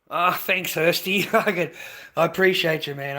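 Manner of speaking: light up thanking a donor; excited thanks. Real, not acted. Thanking Hursty for the donation, excited.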